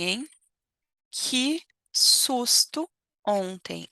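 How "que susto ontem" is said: very slow, stretched que susto ontem; slow emphatic. Shown as a demonstration. Teacher drawing out que susto ontem slowly. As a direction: draw out each word of que susto.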